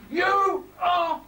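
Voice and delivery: high, slow, hammed-up scolding; mock telling-off. Mother scolding her child, hammed-up and high. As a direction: spell it out like a cross mum.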